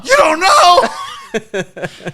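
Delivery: very slow, high, loud incredulous cry; appalled disbelief. Retold or imitated. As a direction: drag it out in horror. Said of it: "appalled at everything".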